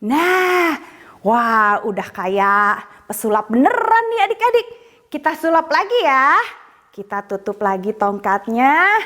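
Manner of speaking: put-on bright, high children's show voice; kids party magician.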